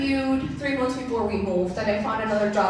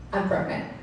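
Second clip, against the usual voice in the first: nervous confession; 3 semitones lower, 4 dB quieter than usual. Retold or imitated. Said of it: "and I'm freaking out".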